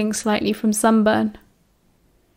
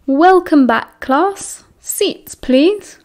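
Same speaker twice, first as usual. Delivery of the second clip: higher, lilting teacher's greeting; cheerful salutation; 6 semitones higher, much slower than usual. A narrator's reading. Professor greets his class after summer, cheerfully. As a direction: sing it out brightly to the room. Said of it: "with a cheerful salutation".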